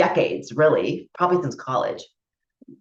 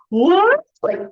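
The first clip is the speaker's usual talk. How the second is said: high, squeaky feigned shock; mock innocent surprise; 9 semitones higher, 4 dB louder, slower than usual. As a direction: play dumb, all wide-eyed innocence. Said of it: "she wrote back".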